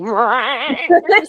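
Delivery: rising cat-like growly yowl; imitated weird cry. Showing what followed "hear it speak", imitated weird yowl. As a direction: mimic a crazy creature noise, climbing in pitch. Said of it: "some weird fucking crazy sound".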